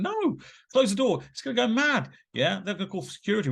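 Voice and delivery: high-pitched, agitated objection; retold alarm. Man refusing to shut the toilet door on her, alarmed. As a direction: start on a long high "no", then urge in mock panic.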